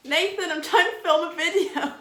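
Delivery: loud, high laughing complaint; laughing scold. Real, not acted. To Nathan shuffling during filming, a loud laughing scold. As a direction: laugh while telling him off.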